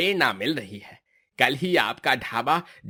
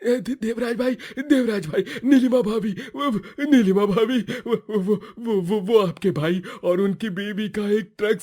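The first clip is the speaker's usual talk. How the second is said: high, breathless, stammering panic; panicked stammering; 8 semitones higher than usual. Acted. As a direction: blurt the bad news in a high panicked stammer. Panicked neighbour brings accident news. (घबराई हुई ऊँची हकलाती आवाज़)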